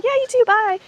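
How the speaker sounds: high-pitched mock "you too, bye"; imitated polite farewell. Two strangers answer her goodbye, imitated high farewell.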